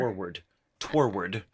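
"Toward" copied as TOR-werd; mimicked extra syllable. Co-host tries out the host's version, two syllables. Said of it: "adding a whole other syllable there".

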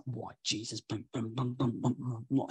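low, soft grumbling under the breath; mock muttering. Stranger grumbling behind the pastor's back, muttered. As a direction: grumble it low, half to yourself. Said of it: "full muttering under his breath".

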